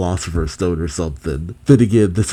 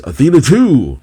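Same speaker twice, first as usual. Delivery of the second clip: higher, louder shouted command; commanding call; 6 semitones higher, 6 dB louder than usual. A narrator's reading. Boro Boro commands Athena into battle, loud call. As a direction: call her name out like an order. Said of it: "Boro Boro then commands".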